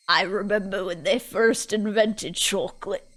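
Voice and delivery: ancient cartoon crone reminiscing; creaky cartoon granny; SpongeBob old lady. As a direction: imitate a corpse-like old woman remembering. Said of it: "the old lady from SpongeBob voice".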